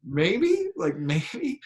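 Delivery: high, rising unsure tone; sheepish hedge. Retold or imitated. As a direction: sound reluctant to admit it. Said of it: "I was like".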